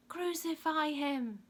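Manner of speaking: high, loud, drawn-out mob yell. A narrator's reading. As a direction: yell it as a crowd would. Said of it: "they yelled".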